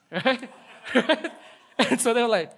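real amused laugh breaking into the words. Real, not acted. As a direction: crack up while telling the story. Preacher cracks up at own retelling.

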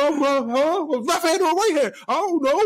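put-on high, slow, unsure kid voice spelling; mock small boy guessing letters; imitated little kid. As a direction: spell it out like a lost child. Confused kid.

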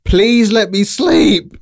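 high, loud, drawn-out wail; desperate plea. Retold or imitated. Kept awake by foxes all night, desperate loud plea. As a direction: slowly yell it like someone begging for rest. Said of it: "I'm like".

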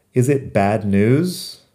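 "Is it bad news?" said slowly, word by word; slow model reading. Shown as a demonstration. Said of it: "otra pregunta".

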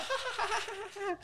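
high, soft fake ha-ha; mock laughter. Shown as a demonstration. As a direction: demonstrate laughing at someone, softly and high. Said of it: "they don't laugh at you".

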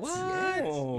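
high stunned what; shocked. Real, not acted. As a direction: gasp it out in disbelief. Shocked by fifth kid news.

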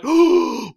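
sharp mock gasp; shocked gasp.

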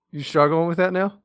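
ordinary talk, nothing marked

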